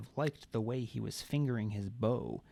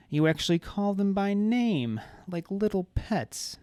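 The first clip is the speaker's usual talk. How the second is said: playful feral; 8 semitones higher, 7 dB louder than usual. A narrator's reading. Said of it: "voice playful and feral".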